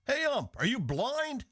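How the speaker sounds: high yelled jeer at the umpire; retold ballpark heckle. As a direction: holler it from the stands like an angry fan. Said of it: "I can still hear him shouting".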